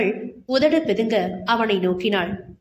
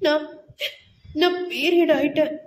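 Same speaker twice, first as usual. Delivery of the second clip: higher-pitched tearful stammer; sobbing; 4 semitones higher than usual. A narrator's reading. Wife telling husband her period has come, sobbing. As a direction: break it up with acted sobs. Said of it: "அவள் தேம்பியபடி கூற".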